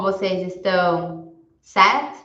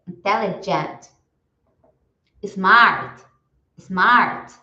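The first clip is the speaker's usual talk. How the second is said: each word said slowly and clearly, then repeated; vocabulary drill for students to repeat; repeat-after-me drill; 5 dB louder, much slower than usual. Shown as a demonstration. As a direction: model every word for the class to echo. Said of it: "Eu vou falar e vocês repetem em casa".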